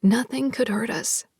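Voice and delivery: usual speaking voice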